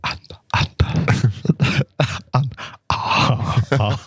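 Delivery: failed calm guide whispering the name; whispered meditation. Retold or imitated. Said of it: "Så jag började viska".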